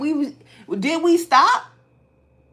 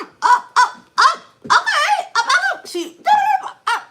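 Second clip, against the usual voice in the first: loud, much higher mock yelling of up up up; retold shouting match; 8 dB louder than usual. Arguing with her sister, loud high mock yelling. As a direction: yell it back, going higher each time.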